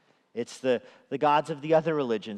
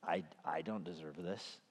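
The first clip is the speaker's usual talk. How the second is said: hurt murmur; 7 semitones lower, 14 dB quieter than usual. Retold or imitated. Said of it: "you're suddenly like".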